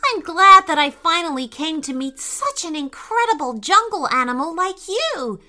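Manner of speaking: put-on high, gushing, flattering boy. A narrator's reading. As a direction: lay the praise on thick. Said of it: "said Isaac with a flattering voice".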